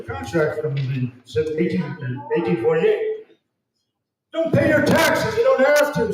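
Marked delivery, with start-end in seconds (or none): 4.3-6.1 emphatic. Real, not acted. Urging audience not to pay.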